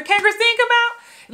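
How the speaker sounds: high sing-song holler; kids calling up. Retold or imitated. Friend at the New York buzzer, imitated high call. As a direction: imitate a kid calling for a playmate. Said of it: "you got that buzzer".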